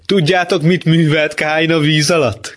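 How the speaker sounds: high-pitched jeering call; shouting. Acted. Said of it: "kiabálta". (kiabálva, magas hangon)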